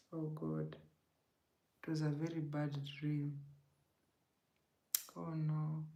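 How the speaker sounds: breathy near-whisper after a sigh; shaken, hushed recollection; hushed dismay. Real, not acted. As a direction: sigh it out, almost voiceless. Upset recalling a bad dream.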